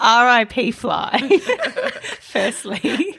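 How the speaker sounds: giggling mock eulogy; solemn words broken by laughter; laughing. Real, not acted.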